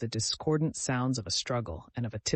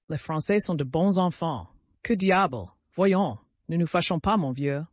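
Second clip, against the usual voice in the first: jovial French officer; 4 semitones higher, 5 dB louder than usual. A narrator's reading.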